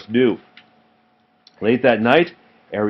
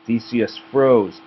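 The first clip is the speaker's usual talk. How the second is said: usual speaking voice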